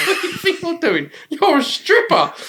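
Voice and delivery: high, laughing indignation; mock outrage. Friend turning down his stripper date, mock outraged. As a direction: protest loudly through laughter, pitch shooting up.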